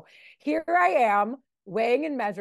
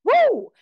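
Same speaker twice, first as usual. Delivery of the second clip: sudden panic whoop; 13 semitones higher, 10 dB louder than usual. Retold or imitated. Panic returns within seconds.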